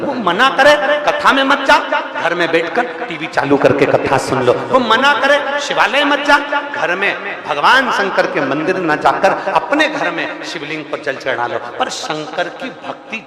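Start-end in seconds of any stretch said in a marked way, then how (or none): 0.0-11.6 loud, high-pitched exhortation; impassioned preaching. Real, not acted. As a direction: urge the congregation on in ringing, insistent phrases. Preaches at full force. (ज़ोरदार, ऊँचे स्वर में प्रवचन)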